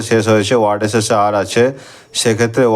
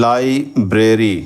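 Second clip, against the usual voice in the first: Library stretched very slowly, parts held apart; slow teacher's syllable demo; much slower than usual. Shown as a demonstration. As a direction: break library into beats for the class. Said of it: "এখানে আমরা দুটো syllable পাচ্ছি".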